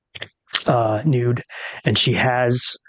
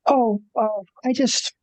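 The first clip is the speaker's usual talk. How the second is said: flustered high voice; 10 semitones higher than usual. Acted. Rosalie flustered by compliment.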